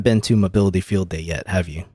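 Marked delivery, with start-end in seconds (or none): none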